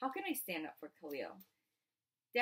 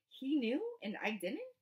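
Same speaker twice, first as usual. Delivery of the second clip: hurt disbelief; 7 semitones higher, 3 dB louder than usual. Acted. Hurt father questions.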